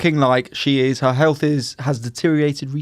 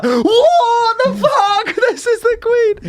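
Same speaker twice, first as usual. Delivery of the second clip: shocked shriek; slower than usual. Retold or imitated. Royalists shocked Queen returns.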